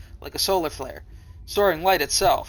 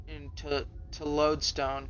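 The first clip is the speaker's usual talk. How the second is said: ordinary delivery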